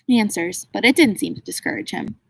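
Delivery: usual speaking voice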